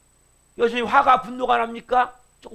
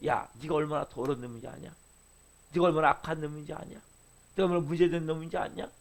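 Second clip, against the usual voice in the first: God's rebuke; 5 semitones lower, 6 dB quieter than usual. Retold or imitated. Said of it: "그래서 빛을 비춰서".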